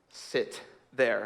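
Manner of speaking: low, soft, controlled command; hushed, deliberate acted sternness; quiet stern order. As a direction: order it quietly, as a disappointed scoutmaster. Scoutmaster's stern order to culprit.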